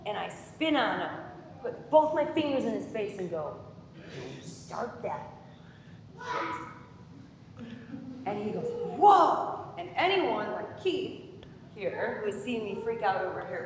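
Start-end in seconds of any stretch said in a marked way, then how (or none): 3.9-7.7 hushed threat. Retold or imitated. Acts out confronting rival caddy up close. 9.0-9.7 high, loud mock alarm; startled exclamation. Rival caddy's startled reply, acted out.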